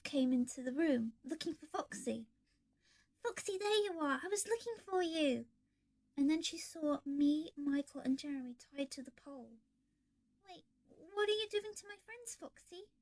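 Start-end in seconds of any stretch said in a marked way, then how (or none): 3.2-5.5 put-on little girl voice. Girl finds Foxy. 10.5-12.9 put-on high, puzzled little girl; bewildered, questioning; confused. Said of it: "she said, confused".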